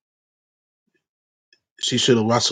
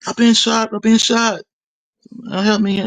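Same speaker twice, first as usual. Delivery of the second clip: much higher, louder panicked cry; retold panicked yell; 12 semitones higher, 3 dB louder than usual. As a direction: cry out that you've been shot, panicking. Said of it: "I heard her, like, yelling".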